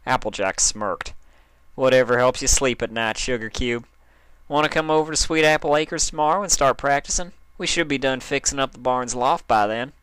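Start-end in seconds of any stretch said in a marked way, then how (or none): 1.7-9.9 smiling. Acted. Applejack teasing sulking Dash, smiling. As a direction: tease her out of the sulk with a smile.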